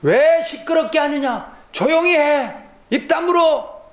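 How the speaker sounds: high scolding shout; crowd rebuking. Acted. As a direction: hush him sharply. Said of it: "꾸짖어 잠잠하라 하되".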